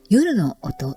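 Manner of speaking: ordinary talk, nothing marked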